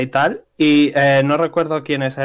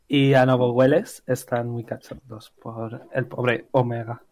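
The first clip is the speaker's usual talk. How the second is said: usual speaking voice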